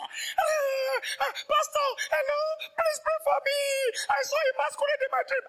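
very high, loud, crying plea; panicky pleading member. Retold or imitated. Church member begging the pastor for prayer, frantic crying wail.

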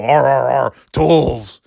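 imitating a grunting tool man; gruff ape-like grunts before tools; Tim Allen grunt. Comic mocking Tim Allen's catchphrase with grunts. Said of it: "all Tim Allen had to do was go".

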